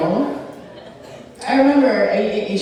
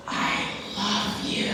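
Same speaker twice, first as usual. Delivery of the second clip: very soft, drawn-out tenderness; hushed imitated mom; 11 dB quieter, slower than usual. Comedian imitates mom talking to granddaughter, hushed and slow. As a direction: breathe it out slowly and softly, imitating mom. Mom's shocking affection to granddaughter.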